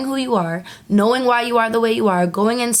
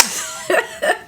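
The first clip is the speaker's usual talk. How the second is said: breathy laugh; 7 semitones higher, 4 dB quieter than usual. Real, not acted. Laughs after teasing repeat.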